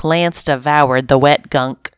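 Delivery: usual speaking voice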